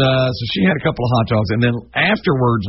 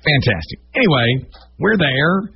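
ordinary delivery

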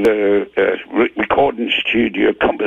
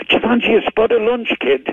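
[name]; 10 semitones higher than usual. Retold or imitated. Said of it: "somebody like McCartney says".

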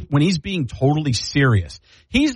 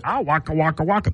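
goofy puppet bear catchphrase; hammy bear comic's waka waka; Fozzie Bear; 2 semitones higher than usual. Retold or imitated. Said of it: "and Fozzie Bear".

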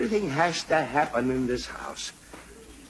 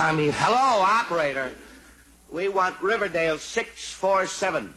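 full-voiced correction; 2 semitones higher than usual. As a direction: clear it up and call out strongly to the operator.

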